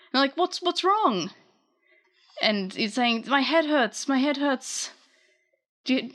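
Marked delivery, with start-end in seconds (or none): 0.4-1.3 high-pitched mock alarm; alarmed question. Retold worried reaction to pain. 3.3-4.9 higher-voiced mock suffering; retold headache complaint.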